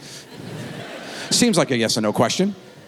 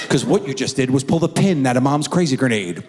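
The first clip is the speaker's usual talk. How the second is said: ordinary talk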